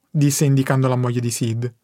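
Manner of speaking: ordinary delivery, nothing marked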